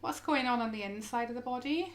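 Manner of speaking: soft, unhurried yoga guidance with long pauses; guided meditation. Acted. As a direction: gently guide them into stillness, phrase by phrase.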